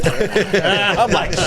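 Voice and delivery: breaking into laughter; laughing. Real, not acted. Recalling the chase with friends, laughing.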